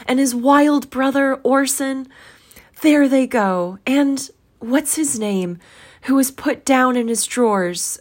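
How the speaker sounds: high, excitable, earnest outpouring; between laughing and crying. A narrator's reading. Scrooge points out story figures to the ghost, overexcited. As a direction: rattle on in a high, overwrought voice. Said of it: "most extraordinary voice between laughing and crying".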